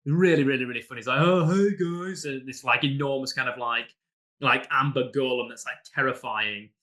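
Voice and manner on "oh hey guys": lightly voiced simple-minded peasant, higher and louder on the greeting; a hint of a goofy, slow-witted giant saying hi; mock dim-witted cheerful hello; dopey peasant. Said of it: "who's a bit kind of stupid".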